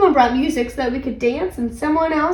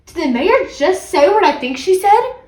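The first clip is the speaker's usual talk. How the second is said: high, loud disbelief climbing to the end; incredulous, rising question; shocked question; 7 semitones higher, 4 dB louder than usual. A narrator's reading. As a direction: ask it as a stunned child. Child stunned by mayor's news.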